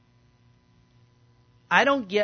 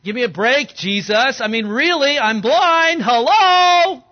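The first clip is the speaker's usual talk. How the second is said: loud, rising to a high cry; a blind man shouting in disbelief at Jesus; louder and louder until a cry of Hello; exasperated yell; 5 semitones higher, 12 dB louder than usual. Acted.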